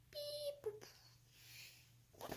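high little robot beeps. Acted. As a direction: beep like a tiny robot butler. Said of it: "He's like the robot butler".

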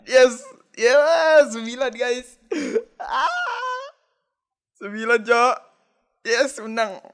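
high, loud shrieks of joy; ecstatic screaming. Real, not acted. Hitting a nine, screaming. (teriakan histeris kegirangan)

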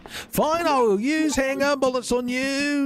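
high-pitched comic rant; mock exasperation. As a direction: push the voice way up in mock outrage at the enemy. Mock rant at a dodging zombie.

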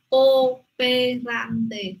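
"Cooperante" very slow, five separate syllables. Shown as a demonstration. Teacher slows down to show five syllables. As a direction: sound out "Cooperante" in five beats. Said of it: "Cinco sílabas".

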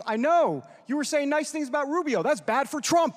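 mock Trump. Guest retelling Trump's phone call ribbing him, hammed-up and laughing. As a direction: needle him like the boss on the phone.

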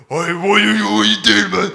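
loud, angry monster voice; Cookie Monster. Acted. Angry sailor questioning Jonah, loud monster voice. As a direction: let anger turn the voice into a monster's. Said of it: "Cookie Monster voice".

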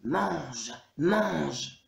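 monsters grumbling to pass time; growling demons. A narrator's reading. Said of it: "claquant les dents et grognant".

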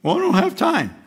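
listener's whiny excuse about time; mock excuse. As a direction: mimic a lame excuse. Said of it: "Notice, make time".

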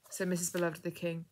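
plain reading voice

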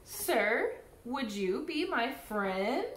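ordinary delivery, nothing marked

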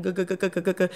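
quiet, repeated "gu-gu-gu" glugs; imitated gulping. Telling how she downed vodka, imitated gulping noises. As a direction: mimic chugging with a run of soft glugs. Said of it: "and I'd down it".

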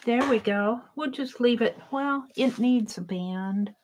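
ordinary talk, nothing marked

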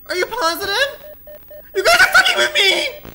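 real agitated yelling; loud disbelief. Real, not acted. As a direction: shout in disbelief at the guys.

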